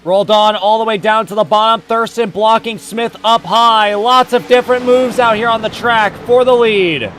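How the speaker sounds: loud, punchy race commentary; live play-by-play. Real, not acted. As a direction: belt out each move for the lead.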